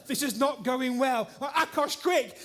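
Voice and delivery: urgently calling a helper to hurry; retold panic. Panicking as plaster dries fast.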